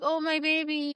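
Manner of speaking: high coo over a newborn; tender cooing. Retold or imitated. Retells cooing over her newborn.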